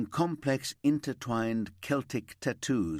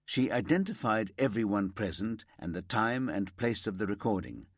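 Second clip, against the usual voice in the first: usual speaking voice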